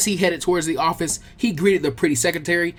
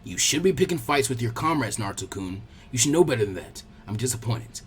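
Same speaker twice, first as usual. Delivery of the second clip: lower, softer, a touch of stern grandfather; disapproving old man; 5 semitones lower, 5 dB quieter than usual. Acted. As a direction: scold him gently but firmly. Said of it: "The old man frowned disapprovingly".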